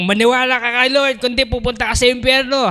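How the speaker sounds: loud, high mock hellfire warning; mock preachy threat. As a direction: ham up a self-righteous scolding. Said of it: "sinabi ko bang ganon? Hindi". (pakunwaring sermon na pananakot, malakas at mataas)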